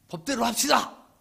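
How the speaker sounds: a man at a heated meeting loudly demanding rules; mock call to order. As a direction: stand up and shout it at the meeting.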